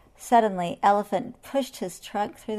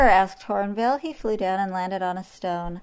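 ordinary delivery

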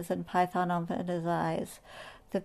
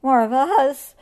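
whimpering; 5 semitones higher, 10 dB louder, slower than usual. Acted. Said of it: "whimpered the monkeys".

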